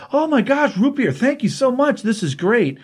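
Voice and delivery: hammed-up kid overjoyed by a treat; thrilled kid. John thanking Alfred for root beer, high gushing delight.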